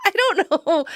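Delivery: high, squeaky-with-laughter delivery; laughing. Real, not acted. Podcaster recalling a hilarious film line, laughing.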